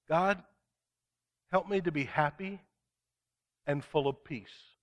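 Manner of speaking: pastor modeling a simple prayer for listeners. Acted. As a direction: pray it gently, as an example.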